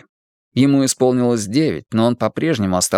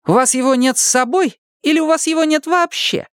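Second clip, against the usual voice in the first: put-on cheeky, higher, taunting boy. A narrator's reading. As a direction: needle him with cheek. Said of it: "нахально спросил Гилель".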